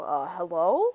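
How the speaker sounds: mock-puzzled answer with a sharp questioning rise; confused phone greeting. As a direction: imitate someone baffled that the phone works. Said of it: "always very confused and go".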